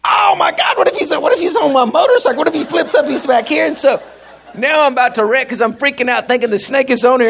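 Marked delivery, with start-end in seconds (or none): 0.0-3.7 high, fast, frantic rush; panicked inner thought. Retold or imitated. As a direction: spill it out in a frightened rush. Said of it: "it just hits me".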